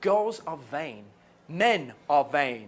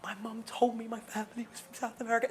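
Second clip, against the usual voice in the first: put-on swooning girl; 4 semitones higher, 7 dB quieter than usual. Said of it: "these girls would just be".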